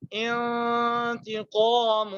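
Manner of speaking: انتقام with the نون hidden in a long nasal hum; slow Quranic انتقام with ghunna; nasal ikhfa. Shown as a demonstration. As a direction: stretch انتقام slowly, the نون sounding through the nose. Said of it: "دوبارہ سے پڑھتا ہوں".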